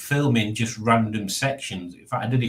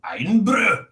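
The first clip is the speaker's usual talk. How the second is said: mock Scottish Iron Brew, rolled and higher; Scottish accent; 8 semitones higher, 3 dB louder than usual. Shown as a demonstration. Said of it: "Is that it?".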